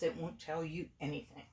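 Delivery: usual speaking voice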